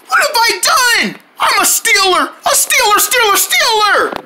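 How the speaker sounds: very high, slow wail of guilt; hammed-up guilty despair; panicked. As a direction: cry out in mock horror. Guilty panic over theft.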